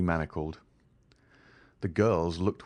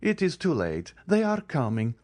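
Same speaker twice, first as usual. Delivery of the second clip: put-on cold young lady; 8 semitones higher than usual. A narrator's reading. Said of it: "said Eugénie".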